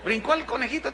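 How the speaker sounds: normal talking voice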